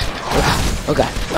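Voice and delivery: usual speaking voice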